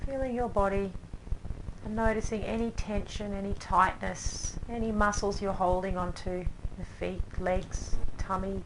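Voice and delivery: soft, unhurried voice with long pauses; calm yoga-class relaxation, leaving space after each phrase; guided meditation. Acted. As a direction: gently guide them down into rest and breath.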